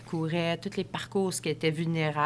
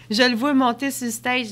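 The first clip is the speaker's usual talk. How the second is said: excited; 6 semitones higher, 8 dB louder than usual. Retold or imitated. Excited retelling seeing Galen.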